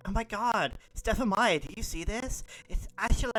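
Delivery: high, loud fangirl excitement; flipping out. Acted. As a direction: alert your friend to a celebrity. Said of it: "are flipping out".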